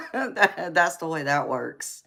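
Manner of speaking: real laughter spilling into the words, settling down; laughing. Real, not acted. Streamer joking about own bedtime habits, laughing. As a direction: talk through the tail of a laugh.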